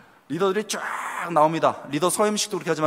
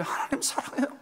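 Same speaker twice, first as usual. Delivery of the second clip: unvoiced, softer prayer; whispered prayer; 7 semitones higher, 7 dB quieter than usual. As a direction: breathe it to God in a whisper. (속삭이는 기도)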